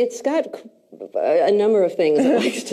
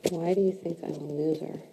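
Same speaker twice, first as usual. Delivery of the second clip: hushed; 8 dB quieter than usual. Real, not acted. Repeats coach's question inwardly.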